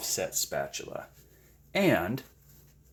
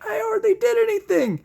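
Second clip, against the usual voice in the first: mock modest; 5 dB louder than usual. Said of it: "and I'm like".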